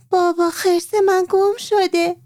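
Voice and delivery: put-on high, slow little girl's plea; pleading little girl. Maryam running to her father about the lost bear, slow high plea. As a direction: drag it out high and slow, close to tears.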